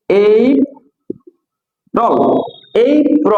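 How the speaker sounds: এপ্রিল in two parts, AY, PRO; correct pronunciation. Shown as a demonstration. Teacher drilling for learners, correct pronunciation. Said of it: "হচ্ছে".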